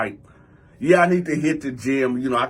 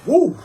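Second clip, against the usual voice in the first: high, loud "woo" like rushing wind; mimicked whoosh; 9 semitones higher, 7 dB louder than usual. Storyteller imitates the wind of a missed punch, loud whoosh. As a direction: imitate a punch swishing past.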